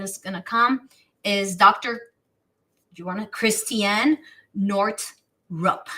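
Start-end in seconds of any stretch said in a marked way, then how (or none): none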